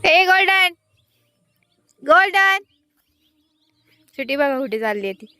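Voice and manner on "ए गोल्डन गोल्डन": calling the dog. Real, not acted.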